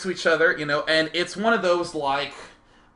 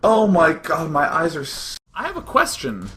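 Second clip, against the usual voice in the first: normal talking voice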